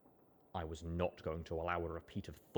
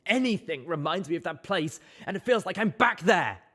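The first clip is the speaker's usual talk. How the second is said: tearful build; 9 semitones higher, 8 dB louder than usual. Acted.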